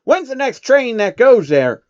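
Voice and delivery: high, loud, slow urgent question; Applejack. Acted. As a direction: demand the next train.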